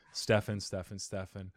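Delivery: practice the name softly to yourself; quiet name rehearsal. Real, not acted. Host warming up before the formal introduction, rehearsing quietly.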